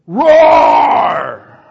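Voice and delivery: loud high roar; Satan's lion roar. Acted. Preacher voicing Satan, roaring loud like a lion. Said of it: "and Satan says".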